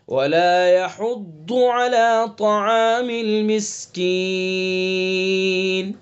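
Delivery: slow, chanted recitation of the verse; Quran recitation. Acted. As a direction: recite the verse slowly and melodically.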